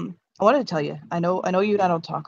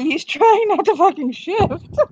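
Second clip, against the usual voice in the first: excited squeak; 10 semitones higher, slower than usual. Acted. Fox bursting with held-in joy.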